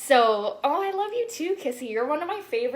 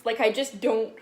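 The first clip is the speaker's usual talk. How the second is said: ordinary talk, nothing marked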